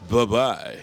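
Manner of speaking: lightly done Kyle sign-off voice; a hint of sitcom Kyle saying goodbye. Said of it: "That's my Kyle voice from Living Single".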